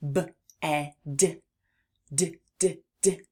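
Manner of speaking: BED split into separate sounds, D repeated short; sounded out letter by letter. Shown as a demonstration. Teacher showing children the end sound, segmented phonics. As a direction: sound out B, E, D with gaps, then bounce on D. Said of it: "We can hear that D sound at the end".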